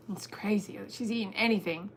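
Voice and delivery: ordinary talk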